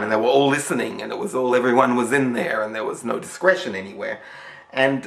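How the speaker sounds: usual speaking voice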